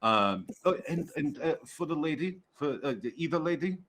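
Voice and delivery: high-pitched little North Country cook; little high voice calling from the kitchen; tiny kitchen voice. Acted. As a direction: fumble along in a small, high character voice. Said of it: "this tiny little voice from the kitchen, North Country voice".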